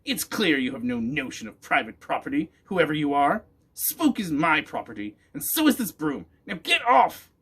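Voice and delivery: loud, rising into a high shriek; scolding witch. A narrator's reading. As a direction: witch scolding, building to a furious order. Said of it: "witch scolding the boy".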